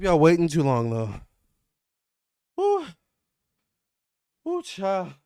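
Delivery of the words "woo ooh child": puffing and panting; out of breath. Real, not acted. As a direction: still winded, gasping a little. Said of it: "while I catch my breath".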